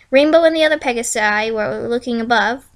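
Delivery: ordinary delivery, nothing marked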